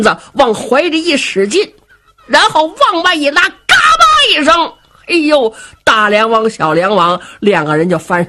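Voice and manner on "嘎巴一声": very high, loud snap; bow snapping. Acted. Storyteller showing the bow breaking, high sharp crack.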